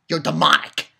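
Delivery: usual speaking voice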